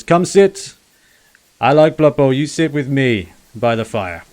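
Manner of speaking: booming welcome from a simple-minded brute; happy ogre. Acted. Ogre to the dwarf, gladly inviting him to the fire, loud and pleased. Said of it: "Uh, he says".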